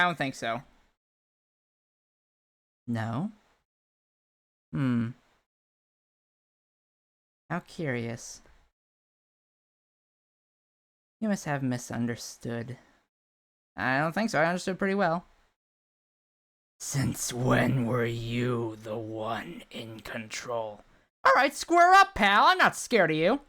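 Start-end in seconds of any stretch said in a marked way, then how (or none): 2.9-20.8 low menacing villain voice. Acted. Villain voice taunting the player. 21.2-23.4 loud, high fighting talk; cocky challenge. Acted. Brave challenge to a foe.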